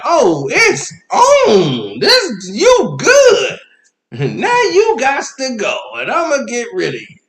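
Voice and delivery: high, loud, hammed-up declaration of war; challenging kid. Five-year-old vows to get rid of boyfriend, mock-menacing shout. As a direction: shout it like a pint-sized rival. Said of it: "he gave me that look like".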